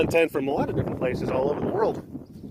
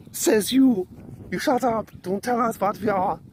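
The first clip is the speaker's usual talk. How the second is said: mock offended Finn; 6 semitones higher than usual. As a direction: snap back like an insulted neighbour. Finnish side retorts in mock quarrel.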